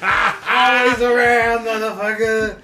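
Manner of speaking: high, drawn-out character intro; hype announcer. Acted. Comic introduces Fat Mowgli the river pirate, hyped call. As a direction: proclaim it with swagger.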